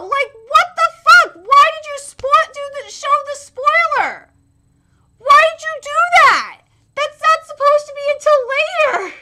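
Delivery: high, loud frustrated complaining; exasperated outburst. Real, not acted. Fan scolding the studio for showing a spoiler, exasperated. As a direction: protest loudly, breaking into frustrated cries.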